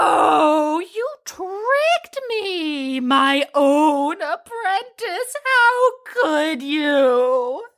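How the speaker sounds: hammed-up heartbreak with stretched vowels; theatrical betrayal. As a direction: wail it slowly like a wounded tragedy queen. Mock betrayal at the apprentice's trick.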